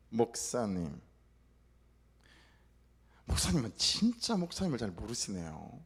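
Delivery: low, needling deaconess voice; lightly mock the pastor; slightly sarcastic. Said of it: "약간 빈정거리듯이 말을 하는데". (여자 집사님이 목사님을 비꼬듯 낮게)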